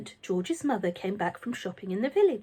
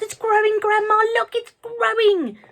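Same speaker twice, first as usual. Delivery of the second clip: excited yell; 13 semitones higher, 8 dB louder than usual. A narrator's reading. George keeps yelling.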